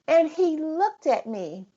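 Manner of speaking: ordinary talk, nothing marked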